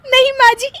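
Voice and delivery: loud, very high words through laughter; shrieky, helpless laughing fit; laughing denial. Acted. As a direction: deny it while bursting into giggles. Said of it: "फिर से हंस रही हो".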